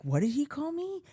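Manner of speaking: mock-offended kid hearing an insult; outraged disbelief. Retelling to a friend, indignant disbelief.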